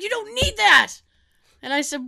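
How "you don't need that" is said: put-on high scolding old-man voice; mock exasperation, loud and high; Tony. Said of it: "He's like".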